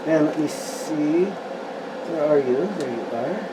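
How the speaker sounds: under his breath, hunting for the piece; talking to himself. Real, not acted.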